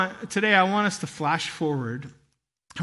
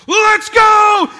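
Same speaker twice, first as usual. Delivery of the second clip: much higher, much louder yell to get moving; shouted command; 13 semitones higher, 12 dB louder than usual. Retold or imitated. Dad yelling to his family in the crowd rush, loud shout. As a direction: bark it to rally the family.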